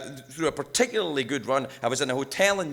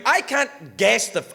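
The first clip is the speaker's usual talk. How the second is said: normal talking voice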